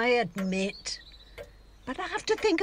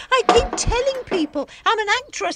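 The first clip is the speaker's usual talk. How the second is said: exasperated outburst; 8 semitones higher, 8 dB louder than usual. Acted. Exasperated about money.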